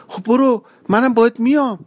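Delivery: put-on high, pushy girl's voice; cling on and insist, lightly voiced; young girl refusing to let go.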